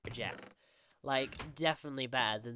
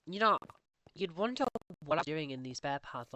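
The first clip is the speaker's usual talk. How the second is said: ordinary delivery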